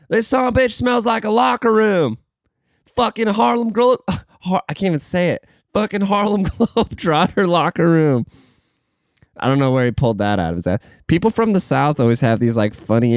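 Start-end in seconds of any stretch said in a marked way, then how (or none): 0.1-8.3 lightly voiced coworker, pitched up, laughing through it; Southern coworker quote. Retelling what the Alabama coworker said, high and laughing. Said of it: "he straight up said".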